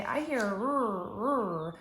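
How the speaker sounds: put-on slow stretched-out child voice sounding r. Kindergartner answering teacher, slow stretched r.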